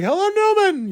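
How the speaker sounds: very high mock audience call-out; heckler's catchphrase. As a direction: imitate a fan calling out from the seats. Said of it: "and somebody was like".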